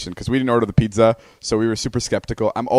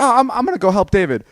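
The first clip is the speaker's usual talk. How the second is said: eager mock voice; 10 semitones higher, 5 dB louder, faster than usual. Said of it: "you're like".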